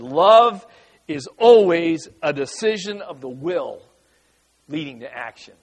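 Love hit loud, then a measured line; quoting a professor. Retold or imitated. Pastor quoting his seminary professor, leaning on Love. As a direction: set the word Love apart and lean on it. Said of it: "a professor at Dallas Seminary that said".

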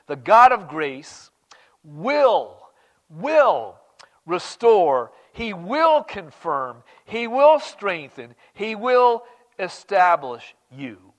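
slow, loud, every will leaned on; slow proclamation. Acted. Preacher proclaiming God's promises to the church, slow and loud. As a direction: proclaim each promise, one by one.

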